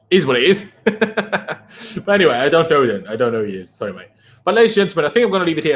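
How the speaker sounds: ordinary talk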